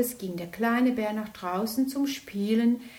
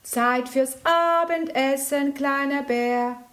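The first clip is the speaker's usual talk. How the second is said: higher, louder call; calling for dinner; 5 semitones higher, 5 dB louder than usual. Acted. Big bear calls little bear to dinner, loud call. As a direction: call him in to eat. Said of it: "rief der große Bär".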